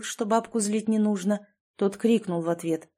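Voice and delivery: ordinary delivery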